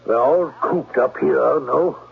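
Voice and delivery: put-on German accent. German-accented Klemper lures the governess to the gazebo. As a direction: charm her in a heavy German accent.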